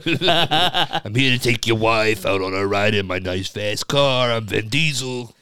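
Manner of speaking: slow, drawn-out tough-guy movie star impression; Vin Diesel. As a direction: play the swaggering action star, unhurried. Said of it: "That's a perfect Vin Diesel impression".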